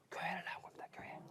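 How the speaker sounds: a soft, awed whisper. Real, not acted. Reveals to the congregation the husband came to church, whispering. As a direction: whisper the good news. Hushed punchline of conversion.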